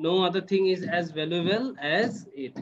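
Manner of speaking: each word drawn out slowly for the student; slow model sentence. Shown as a demonstration. Teacher modelling the sentence slowly for the student. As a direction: dictate it slowly so they can copy. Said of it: "teacher models full sentence slowly".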